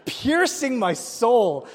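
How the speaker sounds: loud, high, drawn-out melodrama; dramatic outcry. Retold or imitated. Preacher hamming up a coworker's death-ray stare, dramatic outcry. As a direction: stretch it out, loud and anguished in mock horror.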